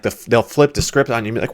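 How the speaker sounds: ordinary talk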